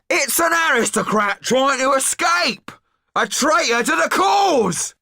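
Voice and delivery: high, slow all-out yell; yelled at the top of his voice. Acted. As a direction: roar it to rouse the mob. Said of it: "yelled Didier at the top of his voice".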